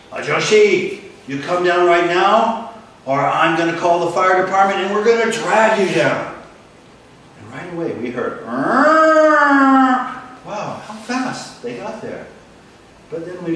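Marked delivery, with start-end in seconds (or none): 0.1-6.3 captain asserting power; stern threatening order. Retold or imitated. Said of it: "about power and control". 8.5-10.2 loud, high wailing siren noise; siren imitation. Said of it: "making the siren sound".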